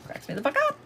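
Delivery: very high, chirpy little bird squeak; squeaky bird voice. Retold or imitated. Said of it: "I have sounded like a fucking bird".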